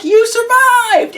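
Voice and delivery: very high, loud, drawn-out mock squeal; hammed-up joyful cry. Heroine greeting the man she thought dead, mock squeal. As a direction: squeal it in exaggerated joy and amazement. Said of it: "And she's like".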